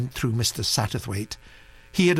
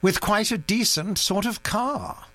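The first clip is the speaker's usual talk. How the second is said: retort; 9 semitones higher than usual. A narrator's reading. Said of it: "retorted Mister Satterthwaite".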